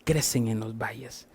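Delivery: hushed, breathy whispered repeat of crecen en los valles. Shown as a demonstration. Pastor repeating the lesson to the congregation, whispered. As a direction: lean in and whisper it like a secret.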